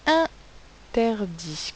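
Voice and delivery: "interdit" very slow, split as in, ter, dit; drawn-out teacher's demo of "interdit"; syllable by syllable. Shown as a demonstration. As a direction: break "interdit" into three separate syllables. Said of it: "Combien de syllabes?".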